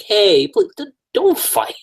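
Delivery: high-pitched, timid stammering plea; mock meek peacemaker voice; Eye Patch Kaneki.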